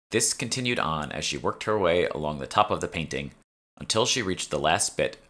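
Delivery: ordinary delivery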